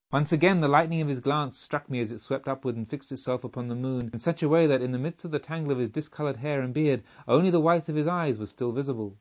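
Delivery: ordinary delivery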